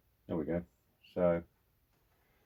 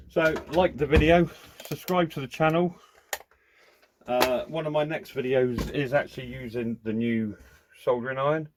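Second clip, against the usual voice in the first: higher, louder YouTube sign-off; bright channel outro, pitched up; upbeat outro; 6 semitones higher, 5 dB louder than usual. Acted.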